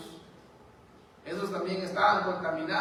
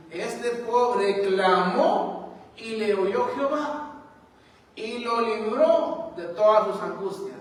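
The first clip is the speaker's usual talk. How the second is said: forceful scripture reading; 5 dB louder, slower than usual. Acted. Preacher reads psalm with force.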